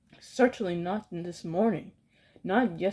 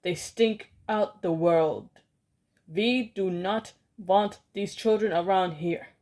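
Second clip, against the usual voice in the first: disgusted rant; 4 dB louder than usual. Acted. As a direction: spit out the disgust. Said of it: "she screamed".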